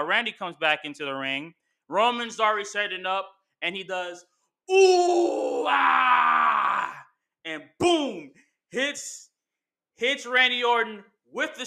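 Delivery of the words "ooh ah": high, loud shouted "Ooh, ah!"; crowd roar. Retold or imitated. Fan imitating the arena crowd, hyped shout.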